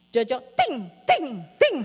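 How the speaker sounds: put-on loud little-kid parroting; punchy, repeated child-like exclamations; small child imitation. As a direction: mimic a toddler copying a scolding.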